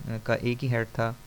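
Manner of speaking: usual speaking voice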